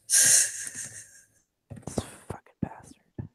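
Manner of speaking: slow breathy whisper under the breath; whispered aside. Real, not acted. Muttering at a character sheet while pulling up the overlay, slow whisper. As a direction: hiss it to yourself, barely audible. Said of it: "whispered aside".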